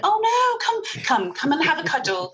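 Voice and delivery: put-on high, soothing baby-talk coo; mock-tender high coo of comfort. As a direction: coax a crying child into a cuddle. Cooing over someone crying.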